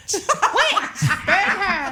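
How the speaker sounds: playful hammed-up yelling; mock witch-hunt shout. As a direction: cry witch like a mob.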